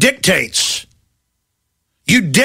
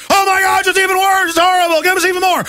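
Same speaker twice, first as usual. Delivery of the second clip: mock banker shrieking for more money; whiny panicked banker; 10 semitones higher than usual. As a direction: ham up a desperate banker begging for cash. Mocking bankers begging for bailouts.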